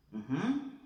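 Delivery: usual speaking voice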